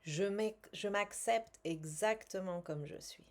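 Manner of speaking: calm, measured guided-relaxation voice; soothing self-help guide voice; guided tapping session. Acted. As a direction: lead them gently through the tapping.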